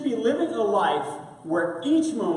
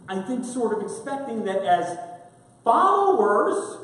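normal talking voice

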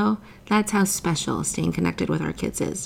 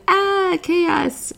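mock alarm; 12 semitones higher, 5 dB louder than usual. Sudden chaos exclaimed.

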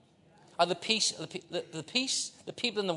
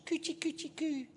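put-on much higher, soft cooing baby talk; 11 semitones higher, 6 dB quieter than usual. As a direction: coo it like tickling a baby. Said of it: "But God goes".

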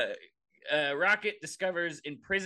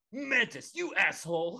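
mock angry; 7 semitones higher than usual. Pretend quarrel, calling Mantis out.

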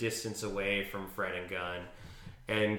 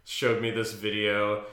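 ordinary talk, nothing marked

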